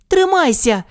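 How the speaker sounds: high urgent cry; mild storybook shout. Acted. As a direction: call up to him to hold on. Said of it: "крикнула вона".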